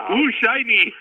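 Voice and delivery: high, gleeful ooh shiny; playful excited squeal; mock delight. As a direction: squeal like a kid spotting a toy. Mock delight at big project.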